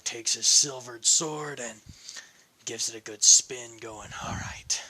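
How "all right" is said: eager whisper. A narrator's reading. Eager before a fight.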